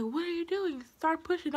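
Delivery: high-pitched imitation of urging staff; mock-urgent, voice lifted high; lightly acted high-pitched prodding; retold urging. Retold staff urging her to push.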